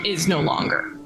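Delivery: normal talking voice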